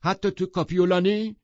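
sharp, high and loud male retort; jealous husband cutting in, high and loud; Tom's sudden challenge. Acted. Tom cuts in on Daisy, voiced sharply high. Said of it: "ناگهان تام گفت".

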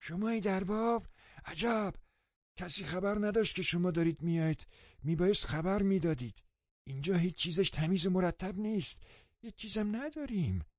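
put-on high, flustered old woman's voice; a startled housekeeper caught off guard; surprised old woman. A narrator's reading. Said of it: "با تعجب گفت". (پیرزن با تعجب و صدای زیر)

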